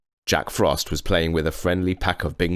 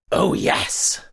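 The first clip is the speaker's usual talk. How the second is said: sly goblin giggle. A narrator's reading. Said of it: "giggling and nudging each other".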